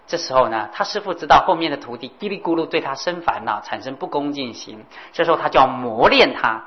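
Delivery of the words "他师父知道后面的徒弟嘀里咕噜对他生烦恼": ordinary delivery, nothing marked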